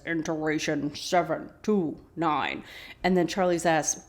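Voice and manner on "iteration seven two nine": slow, measured robot recording; slow mechanical monotone; robotic voice. Retold or imitated. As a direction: imitate a robotic radio voice, word by word. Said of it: "The robotic voice again says".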